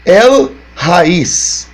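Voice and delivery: "El Raiz" in two separate beats, "El", then "Raiz"; syllable-by-syllable reading. Shown as a demonstration. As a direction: announce "El Raiz" deliberately, syllable by syllable. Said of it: "vai ficar". ("El Raiz" pronunciado sílaba por sílaba)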